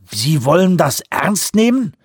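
slow, high-pitched disbelief; incredulous, rising sharply; aghast. A narrator's reading. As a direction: question it as if they've gone mad. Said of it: "fragte Vanderbilt entgeistert".

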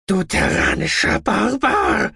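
high, slow furious scream at the attacker. A narrator's reading. Mechanic screams at the gunman who shot his friend, furious. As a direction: scream the insult, outraged. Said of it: "schrie er".

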